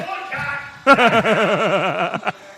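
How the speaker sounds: long, loud laugh. Real, not acted. Host tickled by his own jibe, loud rolling laugh. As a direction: let a big laugh run on.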